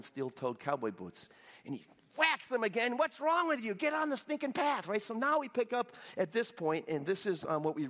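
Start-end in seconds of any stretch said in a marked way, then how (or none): 3.0-4.9 high-pitched mock outrage; exasperated scolding. Balaam to the donkey he is whacking, mock scolding. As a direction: berate a stubborn animal in hammed-up frustration.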